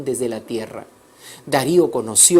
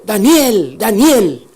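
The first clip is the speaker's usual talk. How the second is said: loud, higher cry of the name; shouted call; 6 semitones higher, 8 dB louder, much slower than usual. Acted. King at dawn calls down into the lions' pit, shouting. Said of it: "y entonces gritó".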